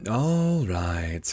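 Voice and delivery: drawn-out cat reply; Cheshire Cat. Acted. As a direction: agree lazily as a storybook cat. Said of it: "said the cat".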